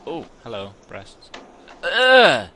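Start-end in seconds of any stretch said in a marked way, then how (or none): 1.8-2.5 high, loud drawn-out "ugh"; loud rising-falling yelp of disgust; loud groan of disgust. Real, not acted. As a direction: blurt a big high-pitched groan. Reacts to character on screen.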